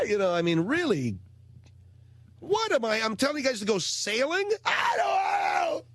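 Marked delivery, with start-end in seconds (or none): 2.4-5.8 mock incredulous. Mocking the kids' reaction.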